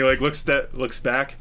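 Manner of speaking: ordinary talk, nothing marked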